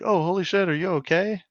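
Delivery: high-pitched, imitated startled concern; alarmed passerby. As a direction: voice a startled bystander checking on someone. Voicing concerned passerby in story.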